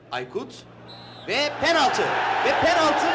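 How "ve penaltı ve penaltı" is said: rising shout of penalty; excited penalty call. Acted.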